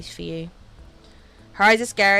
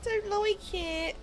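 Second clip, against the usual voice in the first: high, slow, giggly protest; squeamish, drawn-out whimper; creeped-out whine; 11 semitones higher, 4 dB quieter, much slower than usual. Real, not acted. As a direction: squeal it softly through laughter. Creeped out, laughing at the theory.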